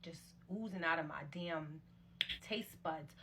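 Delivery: ordinary talk, nothing marked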